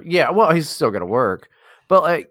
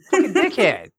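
usual speaking voice

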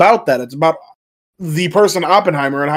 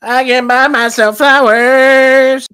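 very slow, higher, louder robotic voice; [name] robot voice; 6 semitones higher, 4 dB louder, much slower than usual. Retold or imitated. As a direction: drag each word out like a stiff robot. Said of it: "That's what [name] sounds like".

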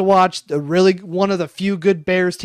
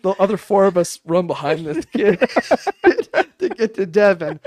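ordinary delivery

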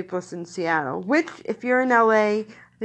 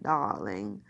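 put-on lower, softer "darling" in a Mid-Atlantic accent; 4 semitones lower, 5 dB quieter than usual. Describing trained actors, light Mid-Atlantic accent. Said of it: "the Mid-Atlantic accent".

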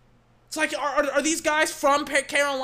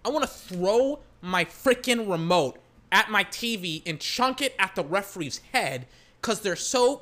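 usual speaking voice

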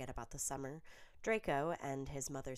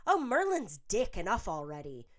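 fed up, stretch the curse out; exasperated moan; 6 semitones higher, 9 dB louder than usual. A narrator's reading. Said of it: "Pansy moaned".

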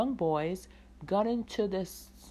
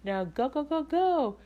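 excited urging; 8 semitones higher than usual. Real, not acted. Urging listeners to go subscribe.

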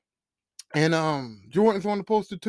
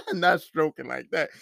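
laughing; 3 semitones lower, 4 dB louder than usual. Real, not acted. Laughs at own joke.

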